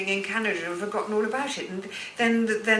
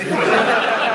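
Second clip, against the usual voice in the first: louder laughing burst; 8 dB louder than usual. Real, not acted. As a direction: laugh at your own joke. Star Trek fan joke.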